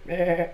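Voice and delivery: quick imitated baa; sheep bleat. Shown as a demonstration. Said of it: "I just barred like a sheep".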